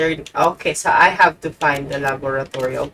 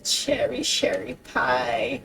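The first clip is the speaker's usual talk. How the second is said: coaxing pet name; 4 semitones higher, 4 dB quieter than usual. Real, not acted. Coaxing the weak girl on.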